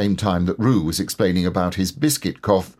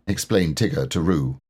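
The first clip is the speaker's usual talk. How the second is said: ordinary delivery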